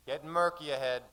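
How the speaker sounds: plain reading voice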